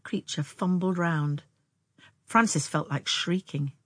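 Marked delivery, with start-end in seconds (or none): none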